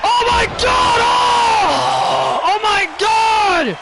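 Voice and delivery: high-pitched, drawn-out cries of disbelief; shocked outcry. Real, not acted. Commentator aghast at Cora's stunt, shocked outcry. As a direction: cry out, stretching each exclamation.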